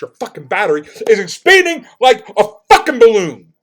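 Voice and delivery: high, very loud outburst; furious shouting. Retold or imitated. Customer to insurance guy about the battery, shouting furiously. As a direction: blow up, shouting it word by word.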